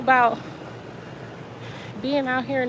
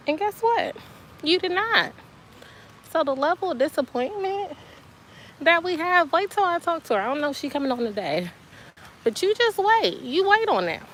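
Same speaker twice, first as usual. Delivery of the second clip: high-pitched indignant telling-off; playful scolding; 7 semitones higher than usual. Real, not acted. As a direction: scold with exaggerated disappointment, voice pitched up. Mock scolding over unsent email.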